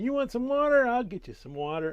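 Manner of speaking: sudden high, loud sarcastic outburst; angry Moses. Retold or imitated. Moses losing his temper at the people, angry shout. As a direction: snap it at the grumbling crowd, fed up. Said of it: "and he loses his temper".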